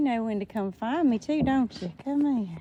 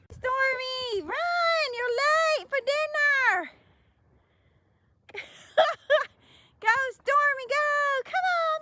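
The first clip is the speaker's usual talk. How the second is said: calling out; 12 semitones higher than usual. Real, not acted. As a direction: cheer her on from across the yard. Calling hens to come running.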